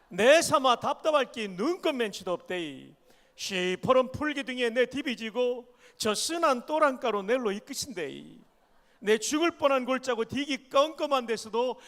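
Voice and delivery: loud, lively Gyeongsang dialect reading. Shown as a demonstration. Pastor reads Psalm 23 to the church in Gyeongsang dialect. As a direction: read the psalm like a gruff Gyeongsang local. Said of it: "경상도 버전입니다".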